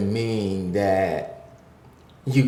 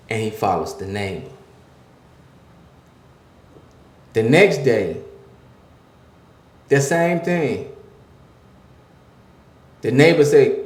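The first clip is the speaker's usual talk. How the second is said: rising, louder preacher's cadence; preaching; 5 semitones higher, 8 dB louder than usual. Acted. Pastor preaching to the men listening, building and louder. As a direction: drive the point home like a sermon.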